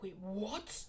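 mildly acted disbelief, rising at the end; softly startled double-take; shocked. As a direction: ask it as a stunned question. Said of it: "they're shocked".

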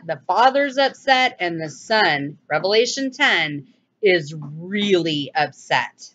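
ordinary delivery

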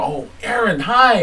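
very high, gushing squeal of a greeting; radio host gushing at a rock star caller; starstruck hi. Retold or imitated. Retold greeting famous caller.

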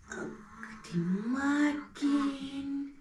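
very slow, drawn-out morning greeting to the teeth; talking to teeth. Pretend. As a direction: wake the little teeth up gently. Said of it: "Seid ihr schon alle wach".

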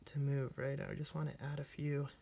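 usual speaking voice

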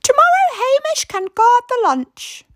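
put-on high, bright old lady voice; wife proposing a plan to her husband; Missus Grinling. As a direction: play a brisk little old wife.

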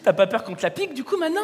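usual speaking voice

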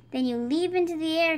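a mild yell mid-jump. A narrator's reading. Saggy showing ducklings how to fly, mild yell. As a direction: call it out as he leaps. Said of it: "he yelled".

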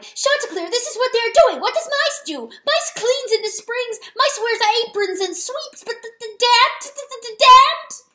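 high, loud wail breaking into stammers; grieving cry. A narrator's reading. Weasel mourning the dead mouse to rooster, grieving cry. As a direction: wail in outrage until the words jam. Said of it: "he cried".

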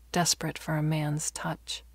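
plain reading voice

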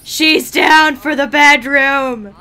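loud, slow, drawn-out cheer; excited shout. Real, not acted. Gamer cheering as her sim agrees to the bedroom. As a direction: stretch it out like a triumphant announcement.

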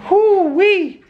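yelped whoo-wee of relief; high loud whoo-wee. Real, not acted. Relief after scare.

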